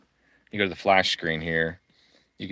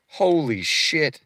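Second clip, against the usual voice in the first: drawn-out, pitched-up holy shit; stunned exclamation; 8 semitones higher, 8 dB louder, much slower than usual. Real, not acted. Streamer reacting to license cost, slow stunned surprise. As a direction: let the price knock the wind out of you. Shocked at four credits price.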